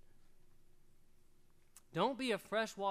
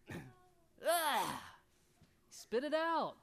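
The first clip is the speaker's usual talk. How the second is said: mock disgust; 6 semitones higher, 4 dB louder, much slower than usual. Acting disgust.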